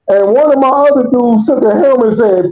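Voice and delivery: usual speaking voice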